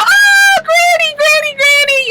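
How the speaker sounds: high, loud, gleeful calling; excited kids. Retold or imitated. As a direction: shriek it like happy kids running in. Kids excitedly greet grandma.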